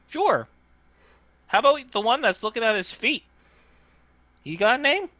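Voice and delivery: high-pitched little tinny voice; small tinny voice. Acted. Hidden voice in the tree asks to see faces, tinny. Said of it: "tinny voice comes from the tree".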